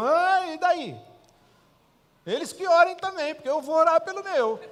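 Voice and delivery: preacher answering the unemployment excuse, defiant; defiant retort. Retold or imitated. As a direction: fire it back, unapologetic.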